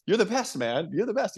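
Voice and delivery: gushing fan. Retold or imitated. Fan telling the doctor he is the best, giddy gushing. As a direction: heap on the praise like a giddy fan.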